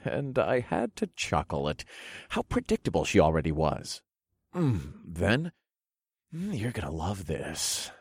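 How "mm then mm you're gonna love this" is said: soft, humming promise; teasing murmur. A narrator's reading. Narrator promising his lover more, softly teasing. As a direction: hum into it, quietly suggestive.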